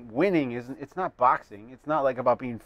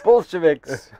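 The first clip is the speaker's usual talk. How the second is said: louder mock Russian accent; 3 semitones higher, 6 dB louder, slower than usual. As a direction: bark it in a cartoon Russian accent. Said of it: "I can do the Russian".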